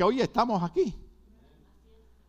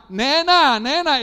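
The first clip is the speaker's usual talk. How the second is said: mock taunt; 6 semitones higher, 6 dB louder, slower than usual. Imagined people calling him nena.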